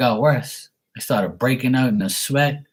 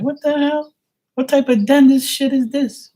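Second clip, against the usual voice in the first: much higher and louder, shrieking disbelief; mock horror at the dentist; shocked outrage; 4 dB louder than usual. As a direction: squeal it like you can't believe it.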